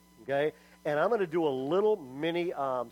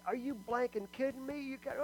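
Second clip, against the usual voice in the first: stunned disbelief; 8 semitones higher, 8 dB quieter than usual. Retold or imitated. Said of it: "Sean's like".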